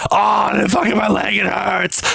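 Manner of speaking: high-pitched imitation of a pained scream; mock screaming. Imitating hurt people in videos, mock screaming. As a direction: wail it like an injured victim. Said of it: "because they're screaming like".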